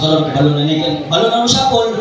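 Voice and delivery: ordinary delivery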